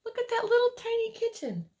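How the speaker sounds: ordinary delivery, nothing marked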